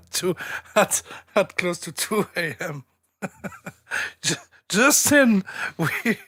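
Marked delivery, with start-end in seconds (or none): 3.2-3.7 high squeaky giggle. Real, not acted. Giddy laughter at 2 AM.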